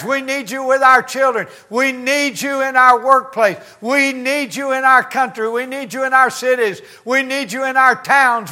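high, insistent chant-like plea building to a cry; fervent preaching litany. Acted. Preaches a rising litany to God.

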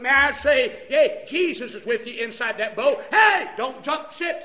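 loud, emphatic pulpit exhortation; full-force preaching. Real, not acted. Preacher urging a single parent to stay aboard, full-force preaching. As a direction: preach it out hard to the congregation.

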